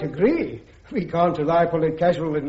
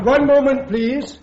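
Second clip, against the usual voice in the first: raised, higher voice to halt him; sudden raised interruption; calling out to stop; 6 semitones higher, 5 dB louder, slower than usual. Acted. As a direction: call him back before he leaves. Judge advocate halts the witness.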